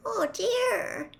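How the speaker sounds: put-on high-pitched dismayed girl's voice; small, high, worried female voice; stricken girl. A narrator's reading. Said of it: "looking stricken".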